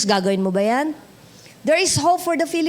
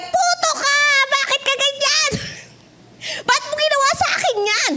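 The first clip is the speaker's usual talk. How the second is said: much higher, whiny mock rant into a pillow; mock tantrum; 14 semitones higher than usual. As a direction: squeal it like a hurt kid venting alone. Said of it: "dun mo sabi". (pasigaw na parang batang nagmamaktol habang nakasubsob sa unan)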